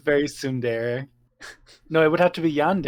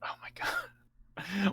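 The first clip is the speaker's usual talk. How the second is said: breathless slow whisper; whispered dismay; 5 semitones higher, 10 dB quieter, much slower than usual. Real, not acted. Cohost groaning at the pun in a slow whisper. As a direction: whisper it in pained disbelief.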